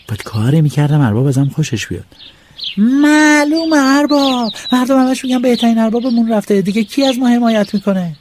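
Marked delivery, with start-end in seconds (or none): 2.8-8.2 butter up the master; boy flattering the landlord; fawning flattery. Acted.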